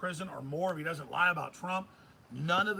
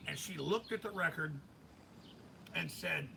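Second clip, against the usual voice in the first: ordinary talk, nothing marked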